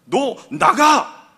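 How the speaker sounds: "너 나가!" yelled slowly and loud; shouted order. Retold or imitated. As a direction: draw out "너 나가!" as a big slow yell. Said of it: "안 그러셔요".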